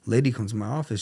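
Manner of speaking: ordinary talk, nothing marked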